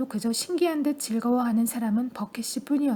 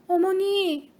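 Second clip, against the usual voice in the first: much higher, shrill alarm; piercing anxious cry; 7 semitones higher, 3 dB louder, much slower than usual. A narrator's reading. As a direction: cry out sharp as an awl. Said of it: "목소리는 불안한 나머지 송곳처럼 뾰족해졌다".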